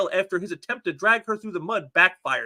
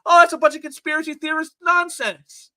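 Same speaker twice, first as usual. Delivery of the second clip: mock dismissal; 7 semitones higher, 5 dB louder than usual. Parroting leftist creators.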